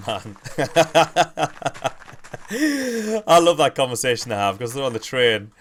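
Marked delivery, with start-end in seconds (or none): 0.4-3.2 high, long laugh ending in a squeal; real laughing fit. Real, not acted. Streamer tickled by bullshit man, high long laughing fit. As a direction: crack up in high, helpless laughter.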